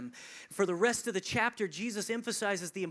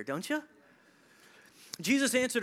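normal talking voice

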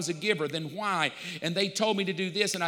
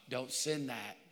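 soft warning; 5 semitones lower, 8 dB quieter than usual. Retold or imitated. God's warning, retold with laugh.

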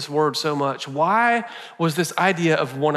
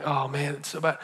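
mock despair; 8 dB quieter than usual. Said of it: "derail us into going".

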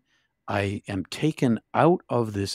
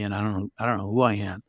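usual speaking voice

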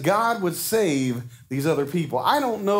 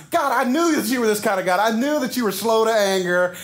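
sarcastic, higher mocking tone; snarky; 5 semitones higher than usual. Jonah snarkily throwing God's mercy back at him. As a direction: sneer the praise back at God. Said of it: "that little snarkiness in there".

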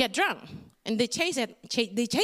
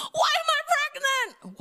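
shocked despair. Retold or imitated. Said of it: "I was like".

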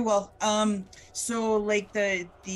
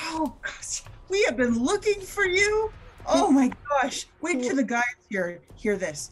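much higher and louder, thrilled; excited; 7 semitones higher, 8 dB louder than usual. Acted. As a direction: burst out with relief and joy. Finding the missing person.

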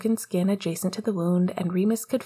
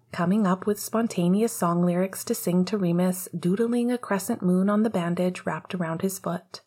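ordinary delivery, nothing marked

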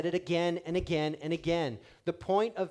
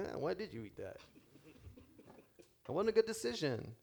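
softer, quick chiding in a put-on voice; mock self-scolding; 8 dB quieter than usual. Pastor voicing a shopper scolding herself, soft mock chiding. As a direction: tut at yourself for the junk food.

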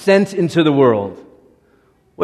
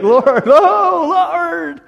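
laughing plea; 13 semitones higher, 4 dB louder, much slower than usual. Acted. Moses pleads, laughing.

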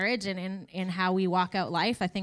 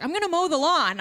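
mock husband; 6 semitones higher, 5 dB louder than usual. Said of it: "He's always like".

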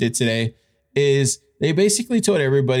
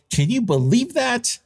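surprised; 10 semitones higher than usual. Real, not acted. Reacting to crypto news.

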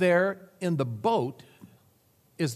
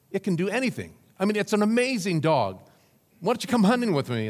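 higher, louder, fast boasting about the dog; excited optimist; 6 semitones higher, 3 dB louder, faster than usual. Acted. Optimist inviting his neighbour hunting, excited bragging. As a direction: gush about the amazing dog, rushing. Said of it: "he says".

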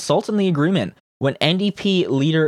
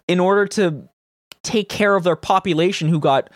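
ordinary talk, nothing marked

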